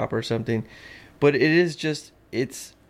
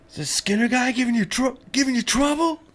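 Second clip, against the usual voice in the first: very high squeaky mob guy voice; mobster quote; 13 semitones higher, faster than usual. Retold or imitated. Quoting the mob guy from the Simpsons, very high squeaky voice. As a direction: squeak it out like a cartoon heavy. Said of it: "The Dubs always says the line".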